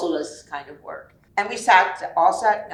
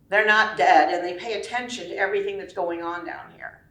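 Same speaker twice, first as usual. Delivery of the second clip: normal talking voice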